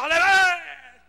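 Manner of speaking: loud, slow hammed-up aleluya; mock congregation cheer, loud and drawn out. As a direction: stretch out a crowd's aleluya. Imitating congregation.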